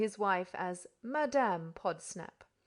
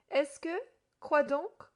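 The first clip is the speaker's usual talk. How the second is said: French questioning; 6 semitones higher, 5 dB louder, slower than usual. A narrator's reading. Frenchman asks him back.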